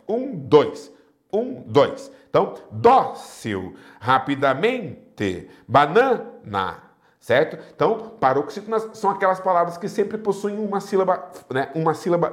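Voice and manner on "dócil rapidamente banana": "dócil, rapidamente, banana" slowly, next-to-last syllable drawn out; stress on penultimate syllable. Shown as a demonstration. Teacher demonstrating paroxytones, penultimate syllable stressed. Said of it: "Penúltima é mais forte".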